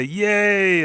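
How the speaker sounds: high, loud drawn-out cheer; acted cheer. Giants celebrating the children's safety, cheering.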